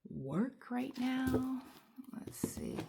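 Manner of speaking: hushed, to yourself while working; a private murmur; whispered aside. Real, not acted. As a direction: whisper it under your breath. Quiet aside while pulling cards.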